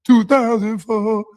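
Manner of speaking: high, slow pitiful imitation; mocking stutterer. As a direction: whimper it like a scared man.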